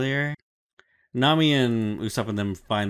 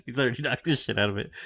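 normal talking voice

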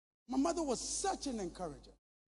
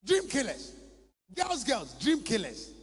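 full-force preaching. Acted. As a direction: hammer it home like a pulpit climax.

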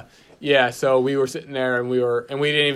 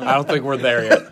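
laughing; 4 dB louder than usual. Real, not acted. Laughing at joke.

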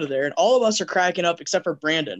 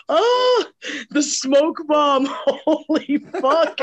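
high-pitched, drawn-out laughing outburst; busting out laughing; 10 semitones higher, 3 dB louder, much slower than usual. Retold or imitated. Friend on the couch suddenly remembers the smoke bomb, bursting with laughter. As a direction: imitate someone helplessly cracking up, words stretched high. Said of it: "just busts out laughing".

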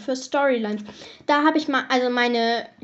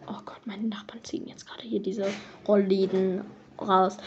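whispered aside; 5 semitones lower, 6 dB quieter than usual. Real, not acted. Distracted by neighbours' noise.

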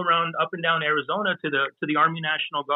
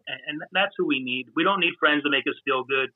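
ordinary delivery

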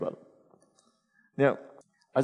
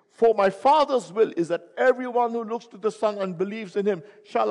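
higher and louder, a ringing proclamation; preaching at full force; 6 semitones higher, 4 dB louder than usual. Acted. Preacher proclaiming Jesus' words to the congregation. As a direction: thunder the verses out to the hall.

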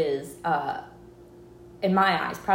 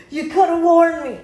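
high, loud "You knew."; accusing friend who already knew, loud and high; shouted accusation; 9 semitones higher, 8 dB louder than usual. Retold or imitated. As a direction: yell it at the friend who kept the secret.

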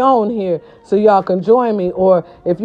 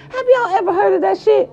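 high-pitched exclaim; 12 semitones higher, faster than usual. Real, not acted. Mock high exclaim at sister.

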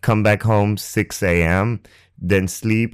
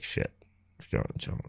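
hushed panic; 16 dB quieter than usual. Retold or imitated. Hushed panic about John.

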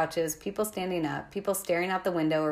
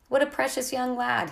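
put-on high-pitched, faintly British accent; British-sounding second-language English; strange British-like accent; 7 semitones higher, 3 dB louder than usual. A narrator's reading. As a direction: condescend in an odd British accent. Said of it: "He had a strange accent, British maybe".

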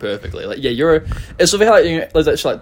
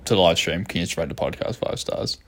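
quiet mock plea; 6 semitones lower, 7 dB quieter than usual. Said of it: "I might switch to".

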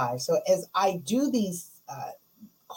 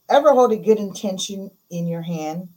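usual speaking voice

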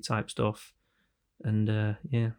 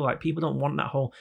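ordinary talk

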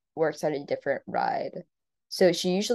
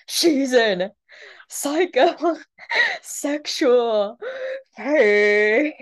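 higher, louder, very drawn-out ring announcer; WWE announcer voice; 7 semitones higher, 4 dB louder, much slower than usual. Pretend. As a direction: stretch psychosexual out like a wrestling announcer. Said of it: "W W E, um, announcer voice".

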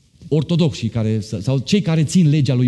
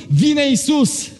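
slow proclaimed shout; 12 semitones higher, much slower than usual. Acted. Climax proclamation of return.

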